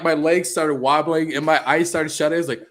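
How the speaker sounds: ordinary talk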